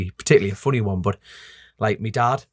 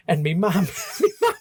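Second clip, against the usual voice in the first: normal talking voice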